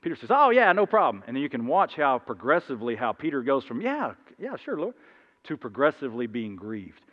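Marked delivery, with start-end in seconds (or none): 0.3-1.2 breezy Peter. Acted. Peter answering casually. 3.8-4.9 eager Peter. Acted. Peter growing unsure.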